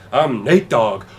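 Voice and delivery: loud, proud self-introduction; loud intro. Retold or imitated. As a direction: announce the name with a punch. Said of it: "I say".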